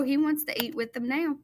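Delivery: usual speaking voice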